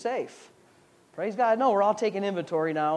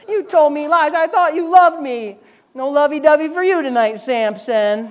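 high, louder mock sulk; pouting Delilah; 5 semitones higher, 6 dB louder than usual. Delilah scolding Samson, high mock sulk. Delilah sulking, voiced higher.

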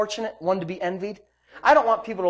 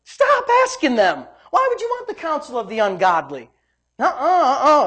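loud, raised preacher's delivery; forceful preaching; 6 semitones higher, 5 dB louder than usual. Real, not acted. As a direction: drive it home to the congregation.

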